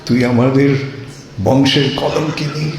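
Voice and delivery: storyteller's lightly voiced rage; mother-in-law berating her in mild anger; angry scolding. A narrator's reading. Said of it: "জটিলা রেগে মেগে বলছে".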